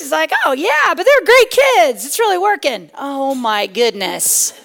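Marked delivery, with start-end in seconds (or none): 0.4-2.9 high, loud mock enthusiasm; cheery neighbor. Neighbor reassuring the yelling mom, hammed-up cheer. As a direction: imitate a neighbor's over-bright reassurance.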